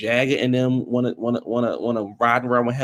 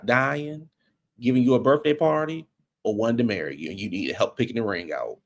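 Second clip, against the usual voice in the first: ordinary talk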